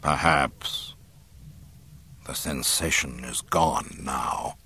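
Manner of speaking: low, slow, measured villain voice; deliberate and low, weighing each word; dark lord musing slowly; brooding Sith lord. A narrator's reading.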